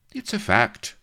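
butter it up slyly; unctuously. A narrator's reading. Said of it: "said Blood unctuously".

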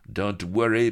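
high, loud Frenchman soothing; hammed-up French pirate soothing the lady; French accent reassurance. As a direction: play the gallant Frenchman comforting her. Happy Dan reassures Vanity.

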